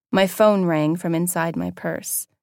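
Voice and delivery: usual speaking voice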